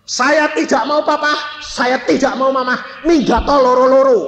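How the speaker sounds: high, forceful refusal building to a shout; hard defiance. Retold or imitated. Child defying both quarrelling parents, hard angry shout. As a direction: reject both, ending in a loud yell. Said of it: "saya itu kerasnya luar biasa".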